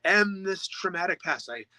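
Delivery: mock wail of someone clinging to their trauma; self-pitying victim. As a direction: play the victim, hammed-up and high. Voicing the wounded self.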